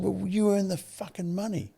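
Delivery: high, drawn-out retort in his father's words; retold indignant retort. Husband snapping back at wife like his old man, indignant. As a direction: throw it back high, stressing YOU, mock-domineering. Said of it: "that was my old man".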